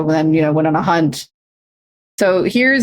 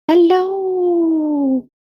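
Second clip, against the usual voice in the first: much higher, drawn-out hello; sing-song hello; 11 semitones higher than usual. Real, not acted. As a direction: call out a high, long hello. Streamer greeting a viewer.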